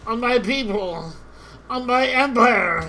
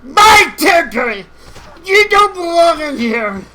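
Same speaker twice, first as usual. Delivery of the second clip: loud, higher territorial yell; angry shout; 5 semitones higher, 8 dB louder than usual. Real, not acted. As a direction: roar them out of your land. Shouting at invaders again.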